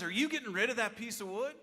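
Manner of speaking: excited neighbor. Retold or imitated. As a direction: imitate an excited neighbor asking. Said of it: "George looks at me excitedly".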